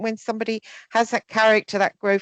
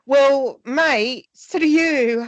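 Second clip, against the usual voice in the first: higher, louder tit-for-tat jab; playful mock snark; bitchy retort; 6 semitones higher, 3 dB louder, much slower than usual. Real, not acted. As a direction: fire it back with a smirk. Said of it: "not being bitchy".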